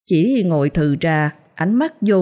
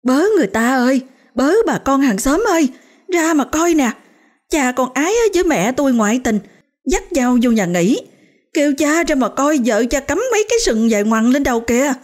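calling out to neighbors; 8 semitones higher than usual. A narrator's reading. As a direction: shout for the whole street to hear. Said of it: "nó đã bắt đầu la làng". (la làng kêu hàng xóm)

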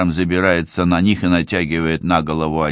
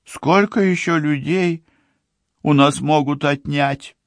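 sobbing; 9 semitones higher than usual. A narrator's reading. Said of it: "рыдает Ливи".

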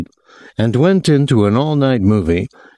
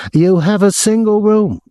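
high-pitched, aggrieved hotel official; aggrieved man; 13 semitones higher, 3 dB louder than usual. A narrator's reading. Hotel man phoning a guest about his visitor, aggrieved. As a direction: put on a high, officious voice of complaint.

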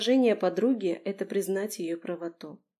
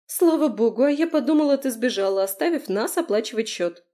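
high, loud indignant friend; 4 semitones higher, 6 dB louder than usual. A narrator's reading. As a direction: reproach them loudly, pitch raised. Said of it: "Возмутилась Лерка".

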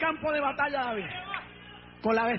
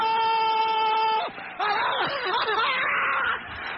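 long, high wordless shout; ecstatic preacher's cry; 7 semitones higher, 3 dB louder than usual. Real, not acted. Preacher at the climax of David's challenge, exultant wailing shout. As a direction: let out a drawn-out, exultant yell.

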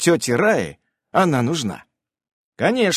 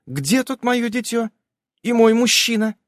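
wife asking; 10 semitones higher than usual. A narrator's reading. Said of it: "Говорит".

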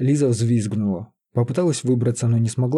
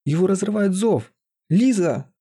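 high, urgent cry; acted alarm; 8 semitones higher than usual. A narrator's reading. Sorcerer calls Лиза as the mage suffers, alarmed. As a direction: raise the pitch in urgent alarm.

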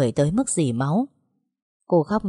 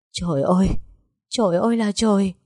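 higher, rising wail of despair; anguished cry; 4 semitones higher than usual. A narrator's reading. Woman crying out alone in her room, anguished. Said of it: "cứ vậy hét lên".

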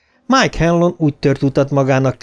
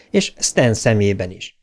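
plain reading voice

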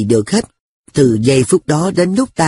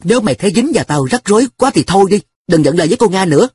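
huffy, quick and higher-pitched; sulky; 6 semitones higher, faster than usual. A narrator's reading. An telling his friend to drop him, sulky. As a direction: tell him off in a mild sulk. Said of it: "thấy nó giận lẫy".

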